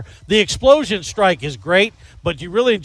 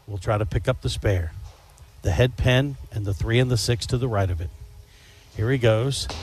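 whispered play-by-play; 6 semitones lower, 6 dB quieter than usual. Pretend. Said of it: "Notice I went to the whisper".

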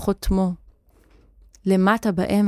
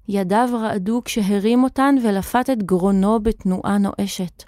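plain reading voice

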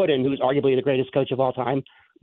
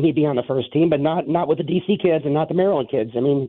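ordinary talk, nothing marked

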